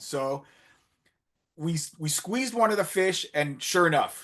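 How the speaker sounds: ordinary delivery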